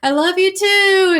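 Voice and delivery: mock-sweet high voice; loving reply. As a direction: gush it back high and sweet. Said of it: "It's like".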